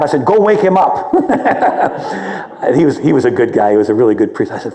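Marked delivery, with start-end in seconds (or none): 0.2-1.1 laughing. Retold or imitated. Laughs while retelling the order. 1.2-2.6 amused laugh. Real, not acted. Laughs at his own story.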